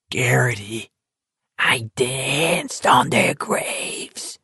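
slow wet gloating whisper; a slow, spitty whisper of triumph; succulent whisper. Acted. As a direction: lean in and whisper it slowly, savouring. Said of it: "dropped to a succulent whisper".

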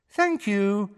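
high, cool official voice; cool female voice. A narrator's reading. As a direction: thank them with impersonal, high-pitched courtesy. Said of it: "said the cool female voice".

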